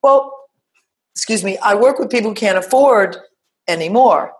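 huffy "excuse me" of a doubter; indignant. Retold or imitated. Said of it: "people going".

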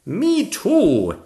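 high, loud eager exclamation; exclaiming knight. A narrator's reading. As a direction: chime in keenly as the knight. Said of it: "exclaimed the knight".

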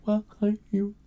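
high, very soft halting murmur; hushed, broken mock speech; Michael Scott. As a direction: trail the words out almost inaudibly. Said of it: "one of the tactics Michael Scott used".

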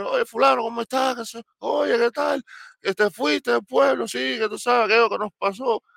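hearty greeting. Retold or imitated. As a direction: greet an old friend, calling out. Greeting old acquaintance loudly.

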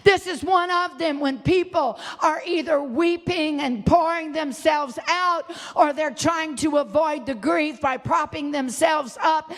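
ordinary delivery

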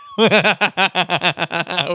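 a rolling laugh at the joke; real laugh. Real, not acted. Host laughing at a mate's burn, amused.

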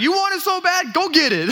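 high, loud cheeky dare; mock toddler taunt. Toddler running from his dad, high mock taunting.

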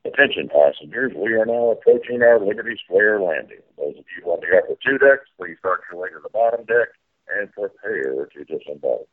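boat landing announcement over the PA; airport announcement. Pretend.

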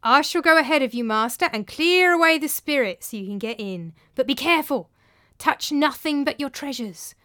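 Tranio cautioning master. Acted.

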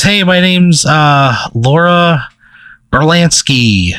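lightly voiced gym teacher introducing herself; Laura. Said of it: "she kinda introduces herself".

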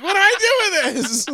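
high, laughing clueless voice; a high, laughing, bewildered question; clueless Paul. Retold or imitated. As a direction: play dumb with the ball.

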